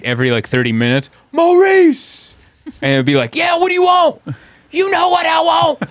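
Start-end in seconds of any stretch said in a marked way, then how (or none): 1.3-2.3 high, loud yell up from the alley; yelled name. Retold or imitated. Street customer yelling up for Maurice. Crackheads yelling for dealer below window. 3.3-5.8 high, loud street holler; mock alley shout. Dealer and customer hollering in the alley.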